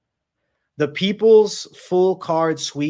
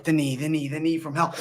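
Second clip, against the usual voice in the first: ordinary talk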